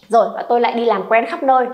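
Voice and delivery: ordinary delivery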